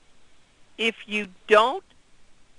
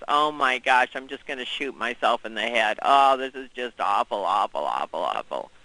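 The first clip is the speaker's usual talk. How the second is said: lower, moaning and self-pitying, sinking deeper at the end; gloomy complaining assistant; 6 semitones lower than usual. Retold or imitated. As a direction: whine about the awful day, fading to a groan. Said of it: "your assistant says to you".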